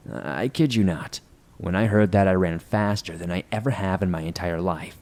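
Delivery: ordinary delivery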